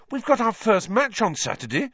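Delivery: high-pitched, indignant protest; outraged boy, very high. A narrator's reading. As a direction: protest in outrage, voice shooting right up.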